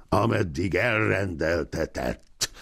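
ordinary delivery

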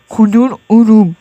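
"Kudururum" slowly broken into syllables; deliberate, stretched "Kudururum". Real, not acted. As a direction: spell out "Kudururum" in slow, separate beats. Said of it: "Mükemmel heceleme".

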